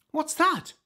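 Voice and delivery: very high tiny voice; squeaky mouse question. Acted. Mice asking, high voice.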